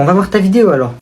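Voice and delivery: ordinary delivery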